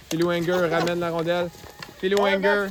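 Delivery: rising call up to a goal; excited sports commentary. Real, not acted. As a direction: build to the goal, then cry out. Live play-by-play to the goal.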